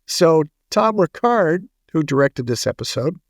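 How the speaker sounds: usual speaking voice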